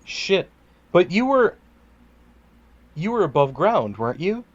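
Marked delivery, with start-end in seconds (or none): none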